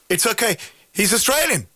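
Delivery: a hint of the concertmaster reassuring the players; concertmaster's announcement.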